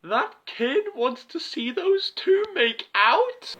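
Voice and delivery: appalled disbelief. Acted. Disgusted disbelief.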